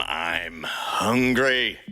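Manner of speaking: usual speaking voice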